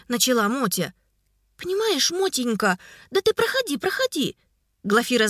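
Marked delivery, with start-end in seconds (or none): 1.6-4.4 high, syrupy coaxing; sugary tone. A narrator's reading. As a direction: coax her in sweetly. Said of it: "на её слащавый тон".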